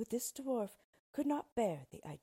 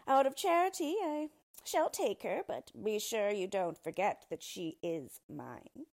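smug yellow dwarf; 3 semitones higher, 7 dB louder than usual. Acted. Dwarf claiming the princess, smug and possessive. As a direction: play the gracious tyrant.

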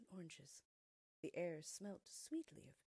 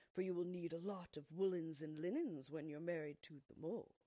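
louder, commanding field mouse; bossy field mouse; 6 dB louder than usual. Acted. Field mouse orders Thumbelina about her wedding, loud and bossy. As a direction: lay down the law to the girl.

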